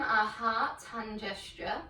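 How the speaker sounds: ordinary talk, nothing marked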